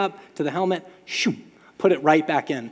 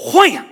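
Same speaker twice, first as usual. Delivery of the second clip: a sudden loud wham, much higher; imitated helmet slam; 12 semitones higher, 12 dB louder than usual. Player slamming his helmet down, loud wham. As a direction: bang it out like the helmet hitting dirt.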